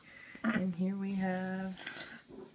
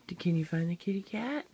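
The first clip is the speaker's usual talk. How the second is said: put-on reading to a toddler; 9 semitones higher, 4 dB louder than usual.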